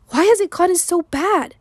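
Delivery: high, anguished plea; distraught question. A narrator's reading. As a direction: plead for an explanation. Distraught friend pressing for answers.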